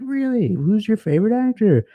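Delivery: light lift of delighted interest; surprised shopkeeper. Retold or imitated. Korean store clerk perks up at a fan. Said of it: "he'd be like".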